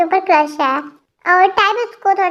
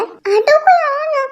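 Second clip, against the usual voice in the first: high, slow voice at the door; 9 semitones higher, slower than usual. Acted.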